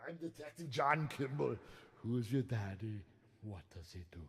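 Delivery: lightly voiced bad Arnold impression. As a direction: do a half-baked action-hero Arnold. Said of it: "That was the worst Arnold impression".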